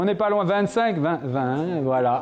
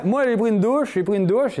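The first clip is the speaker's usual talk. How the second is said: eager students; 7 semitones higher, 3 dB louder than usual. Retold or imitated. Imitates students raising hands.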